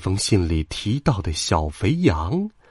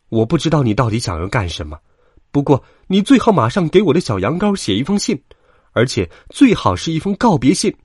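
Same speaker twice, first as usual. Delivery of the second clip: fast, heated ultimatum getting louder and higher; furious; 6 semitones higher, 5 dB louder, faster than usual. A narrator's reading. Sheep mother orders the wolf to write a farewell, furious. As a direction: lay down the order angrily and quickly. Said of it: "他火冒三丈地说道".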